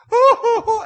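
high, loud hooting mock sob; mock crying. Preacher retelling how the stranded woman broke down, mock crying. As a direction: imitate a woman bursting into tears, hammed up. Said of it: "and she starts crying".

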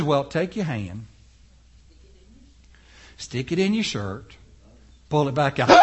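low, soft divine instruction; calm God voice. Acted.

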